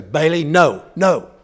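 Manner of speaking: loud, firm no to the dog; firm scolding. Retold or imitated. Owner scolding the dog away from cat food, loud and firm. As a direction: stop the dog cold, loud and stern.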